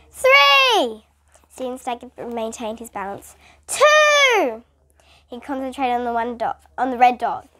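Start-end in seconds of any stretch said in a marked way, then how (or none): 0.1-1.1 high, loud countdown; shouted countdown. A narrator's reading. Principal shouting through cotton balls, loud countdown. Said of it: "had to shout the numbers". 3.7-4.6 high, loud countdown; shouted countdown. A narrator's reading. Principal shouting through cotton balls, loud countdown. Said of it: "had to shout the numbers".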